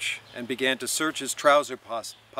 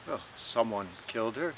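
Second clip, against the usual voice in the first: a touch of gloom, softer; gloomy; 4 dB quieter than usual. A narrator's reading. Stephen answering Mulligan about his mother, gloomily. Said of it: "Stephen said gloomily".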